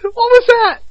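high, loud startled question; baffled outburst; surprised. Real, not acted. Startled reaction.

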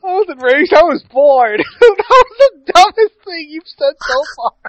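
high-pitched, laughing through words. Real, not acted. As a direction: laugh while mocking it back. Mocking while laughing.

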